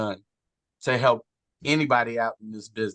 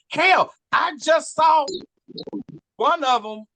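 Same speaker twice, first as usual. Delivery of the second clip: excited shout; 14 semitones higher, 5 dB louder than usual. Real, not acted.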